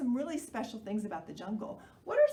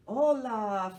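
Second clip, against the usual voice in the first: happy croak; 8 dB louder than usual. Acted. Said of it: "Froggy croaks happily".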